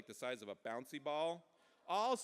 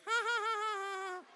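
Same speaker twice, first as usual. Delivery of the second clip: put-on very high baby giggle; baby laugh; 4 dB louder than usual. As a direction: squeal out a rapid baby "ha-ha". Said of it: "and he'd be like".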